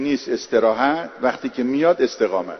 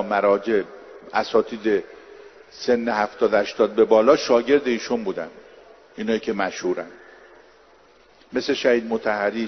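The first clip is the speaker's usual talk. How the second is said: normal talking voice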